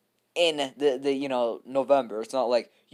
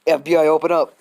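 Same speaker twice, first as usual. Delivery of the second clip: louder, higher mock agent shout at the door; FBI raid shout; 4 semitones higher, 10 dB louder than usual. To the fans, mock FBI agent pounding on the door, shouting. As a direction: bark it like cops at the door. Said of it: "you guys want that".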